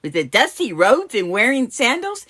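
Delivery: high, loud, incredulous question; scoffing disbelief. Acted. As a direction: scoff at the very idea. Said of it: "you scoff?".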